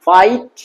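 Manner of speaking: ফাইট with long 'a' into short 'i', rising; slow model ফাইট; long diphthong. Shown as a demonstration. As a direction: stretch ফাইট upward into the 'i'. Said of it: "হর্ষ ই আসছে".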